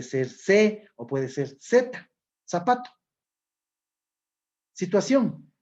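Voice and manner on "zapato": zapato with Z said as S; no ceceo. Shown as a demonstration. Teacher showing Ecuadorians' own pronunciation, zapato with an S. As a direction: say zapato with a plain Latin American S. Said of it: "Todas las palabras pronunciamos igual".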